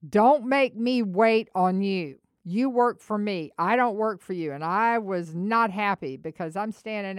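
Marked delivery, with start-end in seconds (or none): 0.1-4.5 firm and strong. Retold or imitated. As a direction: lay down the law to the employee. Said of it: "in a really firm and strong voice".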